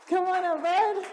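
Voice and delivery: high, loud welcome; excited call. Real, not acted. Mother calling her son up to the stage, excited. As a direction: call him up with delight.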